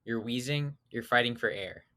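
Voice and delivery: normal talking voice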